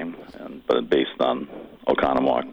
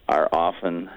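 ordinary delivery

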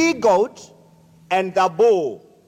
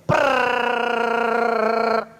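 a rolled prrr like a rattling trap; rattling trap noise; 3 semitones higher than usual. Acted. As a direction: roll the r like something rattling under the bed. Rattling sound under the bed.

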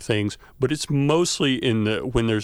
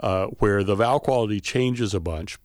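ordinary talk, nothing marked

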